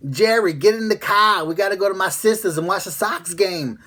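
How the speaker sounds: loud, high Boston accent, hammed up; yelling Boston lady. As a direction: yell like a Boston wife summoning her husband, accent thick. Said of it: "Boston accent consists of some lady yelling".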